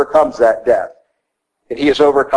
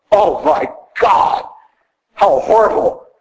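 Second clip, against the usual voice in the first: mock horror; 5 semitones higher, slower than usual. Mocking dread of no TV.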